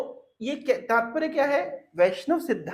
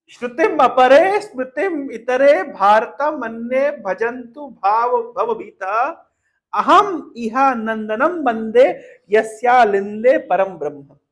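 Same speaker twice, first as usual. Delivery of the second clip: slow, emphatic Sanskrit recitation; verse recitation; 6 dB louder, slower than usual. Shown as a demonstration. Preacher recites the whole shloka to devotees, slow declamation. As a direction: declaim the shloka line by line, drawing out words.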